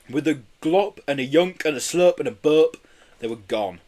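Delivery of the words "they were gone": ordinary delivery, nothing marked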